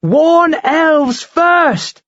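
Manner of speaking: high, slow insistent cry; insistent shout. Acted. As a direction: hammer the point home. Mara insists.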